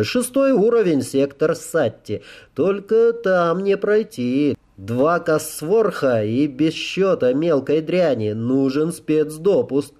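slow, high-pitched reluctant officer. Acted. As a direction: spell out the obstacles in drawn-out phrases.

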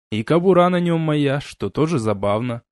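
ordinary delivery

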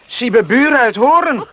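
loud, mocking jealousy; teasing. Jealous boy teasing the girl. (luid en plagerig jaloers)

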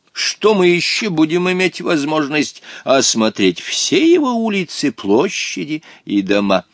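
put-on high, slow old-man reassurance; soothing Hottabych. A narrator's reading. Old companion reassuring the boy about sightseeing, soothing. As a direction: soothe in ornate, drawn-out speech. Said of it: "успокоительно заметил Хаттабыч".

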